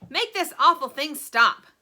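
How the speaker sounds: high, loud demanding bark; angry father demanding; angry order. A narrator's reading. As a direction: order it stopped, furious. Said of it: "ordered Mister T V".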